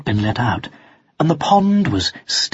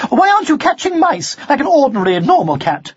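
fast, high-pitched rebuke; sharp scolding; 12 semitones higher, 3 dB louder than usual. A narrator's reading. Mother scolds son for not catching mice, sharply. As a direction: rattle it off as a scolding. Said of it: "she said sharply".

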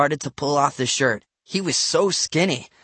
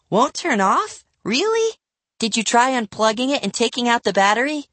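high, questioning little sister; eight-year-old girl; 9 semitones higher than usual. A narrator's reading. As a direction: skeptical child, pitched up. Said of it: "for an eight-year-old".

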